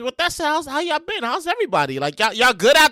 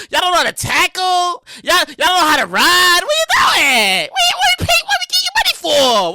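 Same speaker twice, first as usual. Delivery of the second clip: frustrated fan yell; 5 semitones higher than usual. Real, not acted. Fan yelling at losing team.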